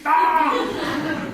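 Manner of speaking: high, loud yelp of dismay; a short comic cry of horror; mock horrified cry. [name] hearing the candidate blames him, yelping high.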